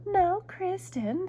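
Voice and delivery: high, slow, drawn-out mother's voice; patient mother, stretched out high; chiding mother. A narrator's reading. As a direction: gently scold in a high storybook voice. Mother chides child in story.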